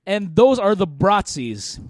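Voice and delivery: normal talking voice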